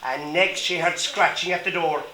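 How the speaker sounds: ordinary delivery, nothing marked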